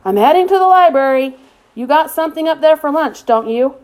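father calling up to his son; calling upstairs. A narrator's reading. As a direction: call up to the boy upstairs. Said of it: "yelling up to Luke".

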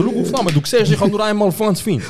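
high-pitched pleading excuse; mock defensive protest. Son pointing parents to the recording, high mock-defensive protest.

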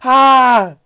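loud, high scream of frustration; frustrated scream. Retold or imitated. Overwhelmed by everyone asking, screaming in frustration. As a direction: let out a wild fed-up scream. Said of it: "así como gritando".

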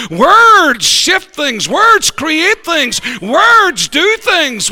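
very slow, weighted preaching; slow and emphatic. Acted. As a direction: lay each word down slowly. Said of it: "slow emphatic repetition".

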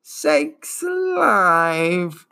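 very slow, gurgling ancient drawl; gurgling old turtle. Acted. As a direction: gurgle it out wearily. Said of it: "Morla gurgled".

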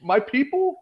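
high-pitched hopeful question; hammed-up high retold voice; imitated nosy guy. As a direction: imitate him sizing up a stranger. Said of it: "He's like".

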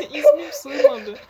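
high, loud laughing words; squeaking through laughter; laughing hard. Real, not acted.

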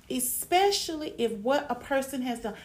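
normal talking voice

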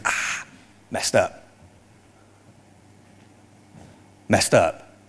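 low-key, softly acted self-reproach; quiet regret. Peter hearing the rooster crow at home, quiet regret. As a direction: say it softly to yourself, with pauses.

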